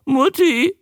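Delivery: high creature squawk; high, drawn-out newt croak; talking newt. A narrator's reading. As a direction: parrot a visitor's question in newt voice. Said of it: "stieß der Molch hervor".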